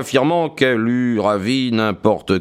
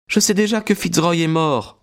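higher, lightly voiced young woman; young woman's voice; 8 semitones higher than usual. A narrator's reading. Maud speaking to Stackhurst, composed young woman's voice.

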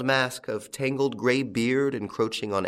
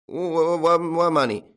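high, loud stuttering tramp; whining, bewildered old man; frightened stammer; 7 semitones higher, 6 dB louder than usual. A narrator's reading. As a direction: stutter helplessly on the first word. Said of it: "stammered old Traynor".

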